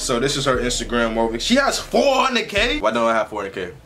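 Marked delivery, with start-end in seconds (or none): 1.4-2.8 high, drawn-out incredulous question; slow it down, pitch shooting up in disbelief; shocked disbelief. Real, not acted. YouTuber sees her follower count, shocked disbelief.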